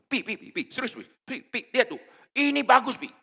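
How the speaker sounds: high, eager, rising pleas; eager wife. Retold or imitated. Preacher imitates wife pestering tired husband, high excited. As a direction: tug at him for attention.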